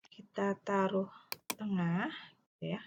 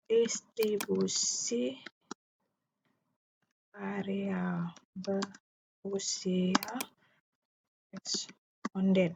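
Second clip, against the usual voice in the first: slow, word by word as typed; dictating; much slower than usual. Shown as a demonstration. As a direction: spell it out slowly while typing. Typing title aloud slowly. (mendikte pelan kata demi kata)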